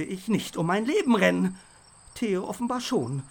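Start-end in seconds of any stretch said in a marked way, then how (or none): none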